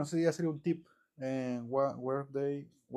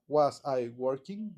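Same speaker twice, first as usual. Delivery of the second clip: "Was I working?" slowly, small pause after "Was"; the wrong way, word by word; much slower than usual. Shown as a demonstration. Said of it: "hace una pequeña pausa".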